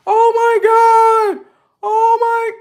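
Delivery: high, slow, shrieking gush over a gift; gushing girlfriend. Acted. Mocking a girlfriend thrilled by a gift, high shrieking gush. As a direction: squeal like a girl dazzled by an item. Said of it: "You're over here".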